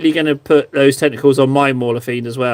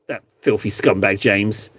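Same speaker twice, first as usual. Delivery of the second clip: low, soft mock-villain sneer at James; hammed-up lower growl of contempt, not meant seriously; 8 semitones lower, 4 dB quieter than usual. Mock villain rant about James.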